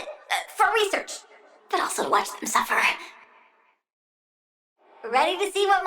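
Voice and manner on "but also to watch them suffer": quick, breathless giggling whisper; whispered with a wicked laugh. Acted. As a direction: let the cruelty slip out in a giggle. Gleeful cruelty, giggled aside.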